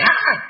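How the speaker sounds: imitating a startled high yelp; short, high mock cry of fright; startled gasp. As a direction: jump with a sudden "Ah!". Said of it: "she'll startle and she'll say".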